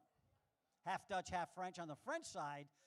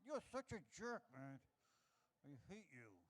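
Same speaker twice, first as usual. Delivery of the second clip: aggravated anteater; 3 semitones lower, 7 dB quieter than usual. Acted. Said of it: "the anteater all aggravated".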